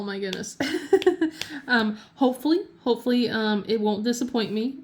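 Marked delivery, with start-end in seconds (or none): none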